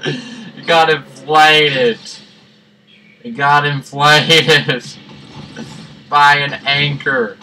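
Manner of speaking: drawn-out mock tragic line; slow mock lament. Gamer mourns the inflated ducky in slow mock sorrow. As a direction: stretch each word like a sad eulogy.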